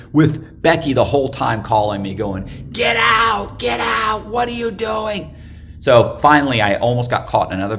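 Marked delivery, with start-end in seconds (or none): 2.7-5.3 very high imitated yelling; frantic girlfriend shouting. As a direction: imitate her yelling down the phone in alarm. Girlfriend calling, urging him out.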